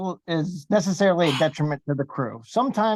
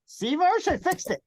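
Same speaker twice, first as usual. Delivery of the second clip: higher, gleeful brag; childishly proud, much higher; proud boasting voice; 11 semitones higher than usual. Acted. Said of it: "be like".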